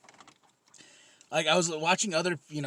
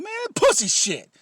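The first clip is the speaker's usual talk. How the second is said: excited declaration; 4 semitones higher, 7 dB louder than usual. Retold or imitated. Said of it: "I was like".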